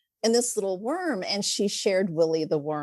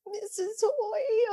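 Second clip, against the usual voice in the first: put-on high, soft, slow little girl voice; little tiny voice; 13 semitones higher, 4 dB quieter, slower than usual. Girl calling her teacher in a tiny, sad voice. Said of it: "in her little tiny voice".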